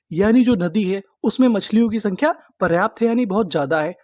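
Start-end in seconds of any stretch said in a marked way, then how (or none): none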